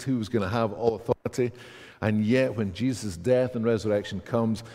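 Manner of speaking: normal talking voice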